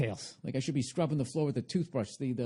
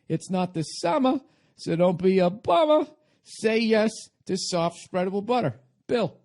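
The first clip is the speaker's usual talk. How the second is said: louder, higher jingle pitch; rhyming slogan; 8 semitones higher, 6 dB louder than usual. Retold or imitated. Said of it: "I wrote".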